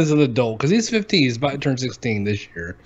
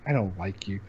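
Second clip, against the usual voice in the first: low, quiet mock sulk; sulky kid imitation; 6 semitones lower, 11 dB quieter than usual. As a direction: mumble it like a hurt child. Imitates son's sulky reply.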